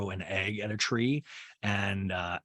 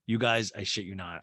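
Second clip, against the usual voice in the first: normal talking voice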